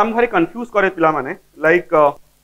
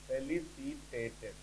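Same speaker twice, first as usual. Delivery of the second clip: ଫେଲିସିଟେଟେଡ୍ dictated slowly and softer; slow dictation; 6 semitones lower, 23 dB quieter, much slower than usual. Shown as a demonstration. Teacher dictating to students, ଫେଲିସିଟେଟେଡ୍ slow and soft. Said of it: "sentence ନବା ଯେମିତି".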